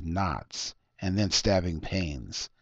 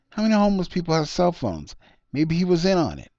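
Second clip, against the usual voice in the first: higher, louder insistent suspect; Harry, insistent; 9 semitones higher, 6 dB louder, faster than usual. Acted. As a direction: push the suspicion hard, pitched up. Harry presses his point.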